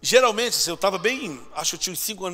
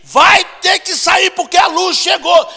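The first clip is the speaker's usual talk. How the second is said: loud, high, slow, stretched proclamation; fiery preaching; 12 semitones higher, 15 dB louder, slower than usual. Retold or imitated. Pastor preaching as the church goes dark, fiery shout. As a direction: thunder each phrase like an exorcising pastor. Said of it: "ele falou assim".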